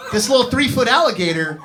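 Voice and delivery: normal talking voice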